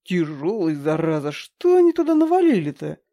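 straining grumble, rising at end; grumbling. A narrator's reading. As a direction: grumble while hauling the heavy bin. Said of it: "проворчал".

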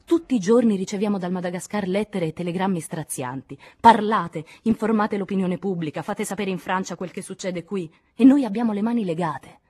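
vehement. A narrator's reading. Lachaume urging Henri to help Madagascar's accused, vehemently. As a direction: plead with mounting vehemence. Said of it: "La veemenza di Lachaume".

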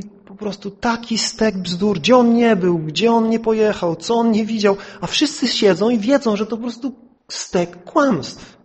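high, emphatic retold complaint; exasperated neighbour. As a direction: imitate a neighbour fed up with a boaster. Retold neighbour complains about relative. (wysokie, dobitne narzekanie zirytowanego sąsiada)

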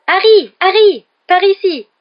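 high, loud shouts to a friend; calling out. A narrator's reading. Hermione hails Harry from the bank steps, shouting. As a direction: holler across a crowd to get noticed.

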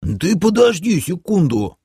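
high, put-on protesting character voice; indignant high-pitched objection; indignant half-orc. A narrator's reading. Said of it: "возмутился полуорк Ишак".

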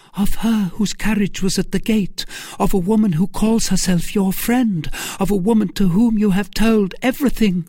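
high, pressing questioner; rising urgency, phrase after phrase; urgent young man. Acted. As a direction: press her anxiously, pitched high.

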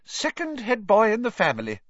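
high, loud motherly pride; proud, lifted mother's voice; swelling with pride. A narrator's reading. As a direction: boast of your son. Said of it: "swelling with pride".

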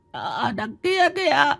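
put-on high, slow, frightened young woman's voice; terrified trembling. A narrator's reading. Shaken girl pointing out the ghost to the crowd, terrified. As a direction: stammer it out, still shaking from the ghost. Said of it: "Hoàng Anh run run chỉ tay".

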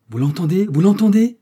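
high, loud question, rising and building; crying out. A narrator's reading. Millicent appealing to the court, crying out. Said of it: "s'écria Millicent".

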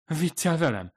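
high, shaky-voiced frightened moan; beg, half in disbelief; moaning plea. Fumiko on the floor moans at the ghost, frightened. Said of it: "nyögdécselte".

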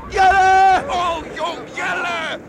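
loud anguished call of a name; desperate shout. Acted. Watcher screaming to the boy lost in the waves, loud anguished call. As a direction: scream the name across the water.

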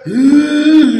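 loud, drawn-out "huh"; threatening grunt. Acted. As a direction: strain it out as if winding up a punch. Pilgrim about to strike the Prophet.

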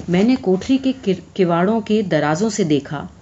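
plain reading voice

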